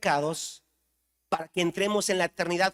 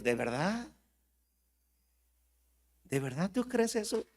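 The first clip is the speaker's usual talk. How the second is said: God's skeptical question; 3 semitones higher, 6 dB quieter than usual. Acted. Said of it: "Dice Dios".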